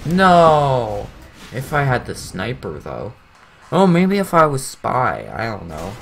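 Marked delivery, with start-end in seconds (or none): 0.0-1.1 loud, higher drawn-out cry; dismayed shout. Real, not acted. Gamer reacting to a setback in play, dismayed shout.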